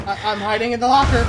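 panicked. Real, not acted. Hiding from monster, shouting.

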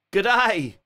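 loud, high, badly faked Australian greeting; bad Australian accent. Streamer mimicking Australians he hears, bad Australian accent. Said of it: "really bad impression of it".

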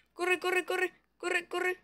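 urgent high calls to run; panicked running away; being chased. Real, not acted. Fleeing the boss in game.

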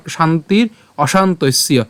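slow, distinct শান্তির অশান্তস্য; demonstrated Sanskrit pronunciation. Shown as a demonstration. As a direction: say শান্তির অশান্তস্য slowly, final য like ইয়. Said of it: "উচ্চারণটা কী হচ্ছে". (শান্তির অশান্তস্য ধীরে স্পষ্ট উচ্চারণে)

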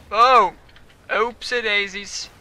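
ordinary talk

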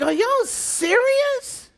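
very high, drawn-out disbelief; incredulous. Retold or imitated. Preacher reacting to cat fights, incredulous squeal. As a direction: squeak it slowly in astonishment. Acts out reaction to catty squabbles.

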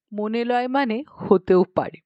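usual speaking voice

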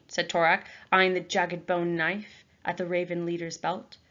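plain reading voice

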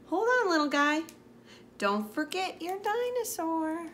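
high voice of a cheerful counter lady; bakery lady voice. Acted. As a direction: play the sweet bakery lady.

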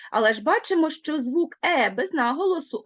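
normal talking voice

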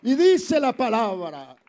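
slow, very loud, high preacher's cry; full-force pulpit proclamation; preaching shout. Acted. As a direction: drag it out and thunder it. Preacher announcing scripture.

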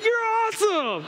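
high, thrilled praise; high-pitched roller-coaster excitement; elated cheering. Retold or imitated. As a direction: re-enact whooping praise at your kid. Dad cheering his son on.

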